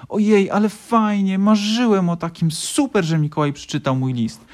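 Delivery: high, loud, stretched-out delight; overjoyed exclamation. A narrator's reading. Little fox unwrapping a model plane, overjoyed shout. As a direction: squeal with joy like a child. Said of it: "wykrzyknął uradowany".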